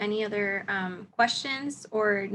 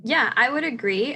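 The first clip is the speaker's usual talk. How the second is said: usual speaking voice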